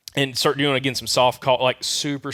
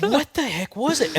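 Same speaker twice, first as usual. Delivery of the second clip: high, drawn-out retold bewilderment; startled disbelief; 12 semitones higher, slower than usual. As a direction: replay the shock, pitched up and slow. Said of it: "I spun around so quick".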